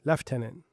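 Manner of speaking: "Lieutenant" said as LEF-tenant; Canadian pronunciation. Shown as a demonstration. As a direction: quietly repeat "Lieutenant" starting with "lef". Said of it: "It's what?".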